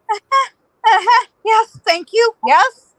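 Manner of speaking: high, jittery mock agreement; nervous eager acceptance. Woman accepting escort from protectors, high nervous assent.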